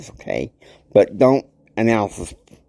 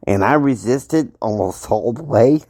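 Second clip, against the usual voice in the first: usual speaking voice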